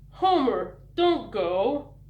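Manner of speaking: put-on loud childlike plea; earnest pleading. A narrator's reading. Little brother begging Homer not to go, loud and earnest. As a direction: beg him not to leave. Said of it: "The little brother pleaded earnestly".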